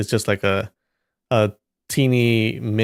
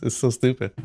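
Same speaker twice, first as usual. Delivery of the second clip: ordinary delivery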